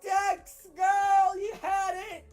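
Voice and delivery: yelling in disbelief, very high and loud; excited shout. Real, not acted. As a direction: scream it as the game slips away. Dramatic loss, commentator yells.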